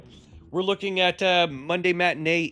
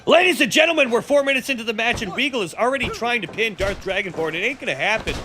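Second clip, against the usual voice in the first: excited commentary bursting higher at the upset; wrestling play-by-play; 5 semitones higher than usual. Pretend. Commentator calling a sudden three count, excited play-by-play. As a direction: call the match like a ringside commentator.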